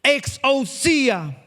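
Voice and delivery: Exousia drawn out very slowly; preacher lingering over the Greek word Exousia; slow emphatic. Shown as a demonstration. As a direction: savour each syllable of Exousia. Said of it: "acento en la I".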